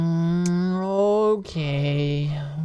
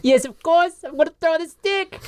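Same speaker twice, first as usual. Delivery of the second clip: high, eager playful squeal; excited high voice; 13 semitones higher, 4 dB louder than usual. Acted. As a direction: gush like playing with a puppy. Nymph eager to play fetch.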